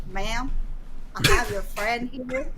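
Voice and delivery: lightly imitated southern sheriff drawl. Sheriff on the phone to the friend, southern drawl. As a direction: stretch it out in a mild southern drawl. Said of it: "in that southern drawl".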